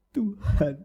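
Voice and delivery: hammed-up sorrowful prayer opening; fake tearful prayer. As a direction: sigh out Tuhan as if crying. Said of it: "doa yang dibuat-buat".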